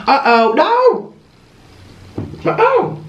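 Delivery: high startled "uh-oh"; alarmed. Real, not acted. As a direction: yelp it in sudden alarm. Sloth making a mess.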